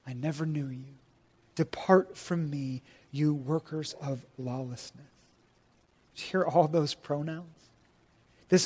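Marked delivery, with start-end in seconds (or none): 0.0-5.0 soft, solemn judgment; a judge's hushed verdict; grave quiet pronouncement. Retold or imitated. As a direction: pronounce the sentence quietly and with finality. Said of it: "And then will I declare to them".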